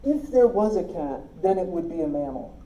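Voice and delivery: ordinary delivery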